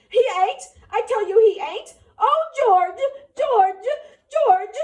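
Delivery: very high, loud desperate cries; screaming for George. Acted. Lenny screaming for George, very high and loud. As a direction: scream out in terror.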